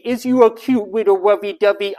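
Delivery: put-on cooing baby talk voice. Shown as a demonstration. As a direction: coo it like to an infant. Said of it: "Now, I don't mean baby talk".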